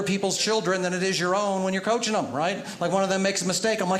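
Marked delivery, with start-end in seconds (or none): none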